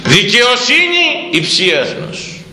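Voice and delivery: very slow, high chanted verse; solemn scripture intoning. Real, not acted. Preacher proclaiming the proverb to the congregation, slow and high.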